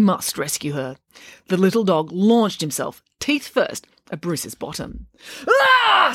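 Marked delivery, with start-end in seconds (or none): 5.5-6.1 loud, high-pitched scream of pain; short piercing yelp. A narrator's reading. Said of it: "screamed Bruce".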